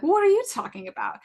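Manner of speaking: high, loud imitated disbelief; retold incredulity. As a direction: act out his astonished protest. Professor disbelieves her insecurities.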